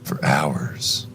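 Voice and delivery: usual speaking voice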